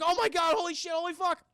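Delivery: high freak-out swearing; mock panic. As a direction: rattle it out high in acted horror. Onlookers freaking out.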